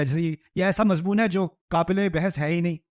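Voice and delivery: usual speaking voice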